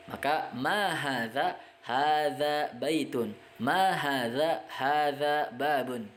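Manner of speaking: Ma hadza rising slowly, then Hadza baitun falling; teacher drilling Arabic question and answer. Shown as a demonstration. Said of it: "Silakan bisa berlatih".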